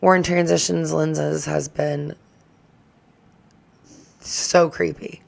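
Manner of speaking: mock ominous verdict; slow creepy hush. Creepy judgement delivered.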